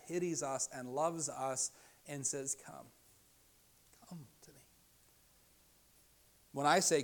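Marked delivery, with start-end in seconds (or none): 2.5-4.6 very soft, gentle call; hushed welcome with a pause after "Come"; tender invitation. Acted. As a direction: drop to a quiet, loving plea. Jesus inviting with pity and love.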